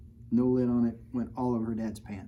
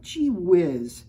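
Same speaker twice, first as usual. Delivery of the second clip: louder, higher put-upon brother; a touch of a sighing brother; 6 semitones higher, 9 dB louder than usual. Acted. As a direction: mildly sigh it out like a big brother. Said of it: "He sighed".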